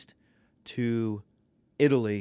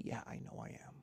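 whispered, smug aside; a hammed-up boastful whisper; 18 dB quieter, faster than usual. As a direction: breathe it out like a self-satisfied secret. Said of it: "And you say".

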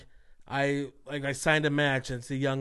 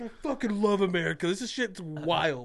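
higher, excited retold cheer breaking into laughter; mock cheering; 5 semitones higher than usual. Retelling friend cheering pyro during the anthem, amused excitement. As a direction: imitate a pumped-up fan, laughing at the end. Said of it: "jumping up and cheering".

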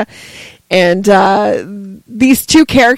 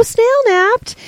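mock horror, much higher pitch; dramatic high gasp; 11 semitones higher than usual. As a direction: gasp it like a melodrama.

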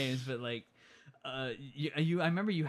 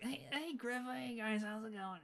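much higher, soft, nervous nerd voice; weird Marty Junior voice; 10 semitones higher, 6 dB quieter than usual. Retold or imitated. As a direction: chirp it like a timid kid. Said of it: "that was super weird voice".

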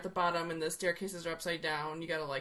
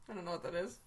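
ordinary talk, nothing marked

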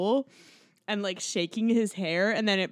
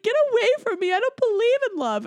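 very high, loud, hammed-up rejection; mock outraged; 13 semitones higher, 5 dB louder, faster than usual. Host voices woman rejecting lying suitor, mock outraged. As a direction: imitate a furious brush-off, pitched way up.

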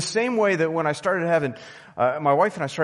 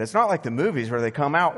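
normal talking voice

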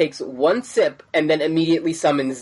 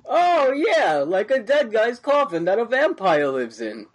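higher, silly deckhand voice; high goofy sailor; 7 semitones higher than usual. Pretend. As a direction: play a dim sailor, pitched up. Playing a sailor in scene.

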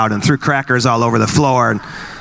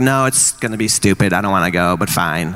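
grumbling wife; 7 semitones lower than usual. Retold or imitated. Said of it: "She's like".

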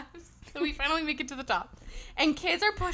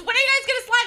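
higher and louder, calling up the slide; raised impatient call upward; 9 semitones higher, 9 dB louder, much faster than usual. Retold or imitated. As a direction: cousin shouting up from the bottom. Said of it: "she goes".

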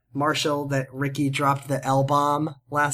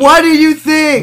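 much higher and louder, slow; loud outraged question; 11 semitones higher, 12 dB louder than usual. Real, not acted. Reacting to the fake ID line, loud and incredulous. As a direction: yell it, incredulous.

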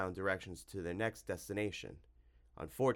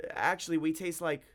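high, loud, frantic bluff; feverish improvising; 9 semitones higher, 7 dB louder than usual. A narrator's reading. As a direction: blurt a desperate idea, pitched up. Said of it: "Tim thought feverishly".

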